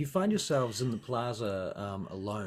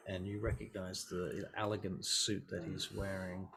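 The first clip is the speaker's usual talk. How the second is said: ordinary talk, nothing marked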